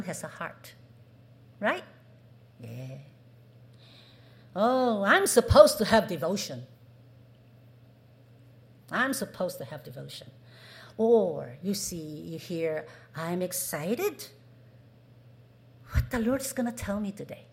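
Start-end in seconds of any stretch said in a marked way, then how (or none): 4.6-10.3 grudging duty. Shown as a demonstration. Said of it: "Do you hear the difference?". 13.1-17.5 excited. Shown as a demonstration. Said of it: "Do you hear the difference?".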